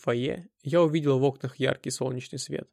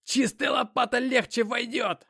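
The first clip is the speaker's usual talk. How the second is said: high-pitched, drawn-out desperate yell; terrible-voiced shout; 10 semitones higher, slower than usual. A narrator's reading. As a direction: scream it from the grave, slowly. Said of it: "страшным голосом закричал я". (истошный крик страшным голосом)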